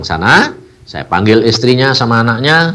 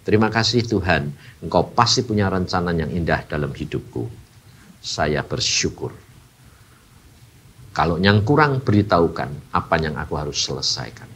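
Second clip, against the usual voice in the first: quiet prayer; 8 semitones lower, 7 dB quieter than usual. Retold or imitated. Quoted prayer to God.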